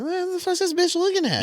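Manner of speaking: high, mocking inner voice; irritated thought. His thought about the staring lady, high squeaky mock gripe.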